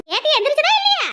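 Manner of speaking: high-pitched impatient question; elder's high, insistent call; calling out demand. Acted.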